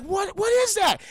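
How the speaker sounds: high, loud, baffled outburst; acted astonishment. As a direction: gasp out the question in mock amazement. Acted astonishment at popping fur balls.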